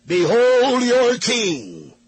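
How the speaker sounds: slow, high, loud proclamation; proclaiming Pilate. Acted. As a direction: announce it grandly to a mob. Pilate presents Jesus to crowd.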